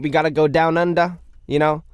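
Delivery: hammed-up Aussie accent; bad Australian accent. Host playing to Australian fans, mock Aussie accent. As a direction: attempt a jokey Australian twang. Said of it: "Sorry if that was a really bad accent".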